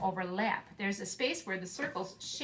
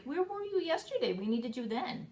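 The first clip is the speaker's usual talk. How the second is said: playful pet talk; 6 semitones higher than usual. Real, not acted. Teacher teasing the pet.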